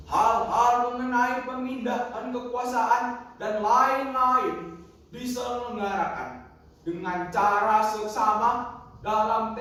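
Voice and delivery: loud, measured oratory with sustained words; proclamation reading. Acted. Grandfather recites the independence text, grand proclamation. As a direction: proclaim it solemnly to a nation.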